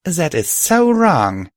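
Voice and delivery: slow, high indignant drawl; slow mock indignation; outraged. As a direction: stretch it out, scandalized. Outrage at the name.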